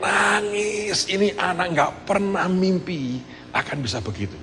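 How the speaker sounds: lifted, marvelling tone; awed retelling of the hug; amazed. Real, not acted. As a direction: wonder at what happened. Marvelling at son's joy.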